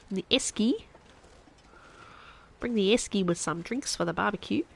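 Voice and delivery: hammed-up Aussie with rising endings; inaccurate, exaggerated Australian accent. Shown as a demonstration. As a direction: do a caricature Australian. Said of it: "That is a very stereotypical Australian accent".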